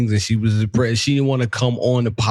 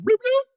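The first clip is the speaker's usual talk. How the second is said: high bloop bloop noise. Retold or imitated. Imitated noise in story.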